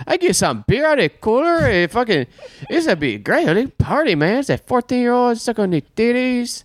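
high, hammed-up party dude; dumb excited guy. The pest guy to Chris, gushing way up high. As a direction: gush like a clueless guy ready to party. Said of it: "And he's just like".

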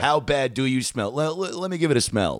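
high-pitched, teasing trash talk; shit-talking. Acted. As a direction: needle a stranger with playful aggression. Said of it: "so rooted in shit talking".

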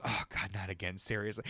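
lightly voiced weary boss; tired exasperation. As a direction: sigh it out like a tired manager. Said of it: "And he goes".

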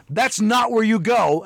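high, drawn-out scolding; alarmed parent. Acted. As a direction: stop a child heading into the street. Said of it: "your parents lost their shit".

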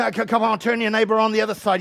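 normal talking voice

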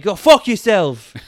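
loud, drawn-out retort; mock yelled comeback; imitated yell. Streamer answering people yelling at him, imitated yell. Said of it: "like, yelling at him".

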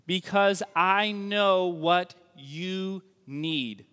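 slow, earnest pleading promise; slow heartfelt preaching; earnest vow. Acted. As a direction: stretch each word out like a vow. Preacher voicing hesed's vow.

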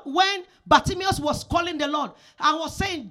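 ordinary talk, nothing marked

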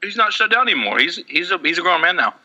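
talking while chewing Skittles; eating candy mid-sentence; mouth full. Real, not acted. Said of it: "[name], are you".

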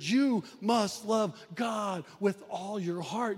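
shouted command. Acted. Preacher stresses the great commandment.

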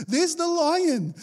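high, slow, through a laugh; amused, high, slow repeat. Acted. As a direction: say it again, laughing.

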